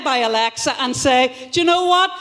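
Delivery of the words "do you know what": indignant tirade, rising to the punchline; rousing angry outburst. Acted. Alexa rising up against lazy men, building mock fury. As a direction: build to a mock-furious shout. Acted revolt led by Alexa.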